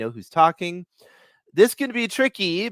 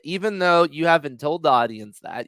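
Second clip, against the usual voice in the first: ordinary talk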